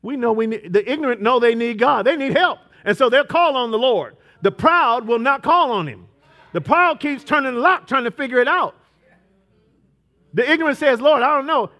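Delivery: high, loud preaching; full-force preaching. Acted. Pastor preaches about the proud and the ignorant, loud. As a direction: press the point hard.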